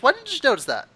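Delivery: high-pitched chiding question; mock scolding. Character scolds companion for missing it.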